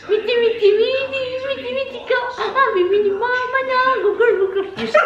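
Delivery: high-pitched, giggly coaxing of the dogs; high laughing pet talk. Real, not acted. Cooing at the dogs.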